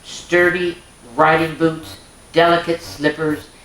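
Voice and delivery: bright, lilting storybook narration; reading to children. A narrator's reading. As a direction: read it like a bedtime story for little ones.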